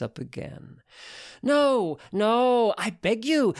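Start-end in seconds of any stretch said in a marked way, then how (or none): 1.4-3.6 high, pushed cries of protest; hammed-up begging, high and insistent; mock pleading. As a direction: beg theatrically, pitched well up. Mock protest against more dancing.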